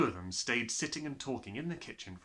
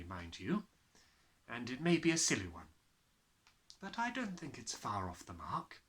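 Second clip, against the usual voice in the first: plain reading voice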